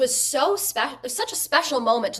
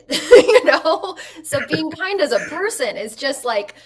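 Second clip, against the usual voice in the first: laughing through the words, higher and louder; 7 semitones higher, 9 dB louder, slower than usual. Real, not acted. Guest laughing while talking about kindness, laughing. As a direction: let a real laugh break into the answer.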